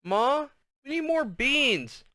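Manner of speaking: put-on high, slow little kid voice; announcing. A narrator's reading. Little brother announcing at dinner, high and slow. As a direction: announce it like a small child. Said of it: "R B announced".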